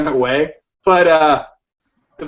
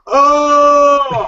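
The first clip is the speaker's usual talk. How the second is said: loud, higher startled "Oh!" like a grown man; grown man's yelp; 7 semitones higher, 6 dB louder than usual. Retold or imitated. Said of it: "an adult male going".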